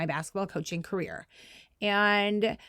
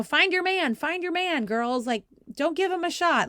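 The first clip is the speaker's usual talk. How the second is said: high, raised calls to the players; sideline yelling; 9 semitones higher than usual. Retold or imitated. Stand-in coach calling to her team, raised voice. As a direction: call out to the girls over the noise. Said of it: "whatever every other parent is screaming".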